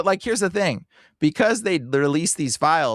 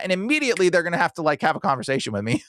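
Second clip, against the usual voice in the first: normal talking voice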